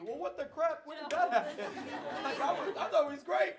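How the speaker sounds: high, loud, laughing mock shock; mock surprise. Husband acting blindsided by his unhappy wife, high mock shock. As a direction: play the clueless husband caught off guard.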